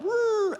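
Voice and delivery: high whirring siren; siren imitation.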